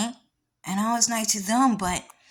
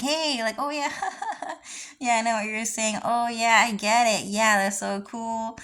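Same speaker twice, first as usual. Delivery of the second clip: high, bright mock small talk with a laugh; imitating casual laughing chatter; mock cheerful chatter; 3 semitones higher than usual. Mocking the people laughing casually, high bright chatter. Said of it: "start laughing like".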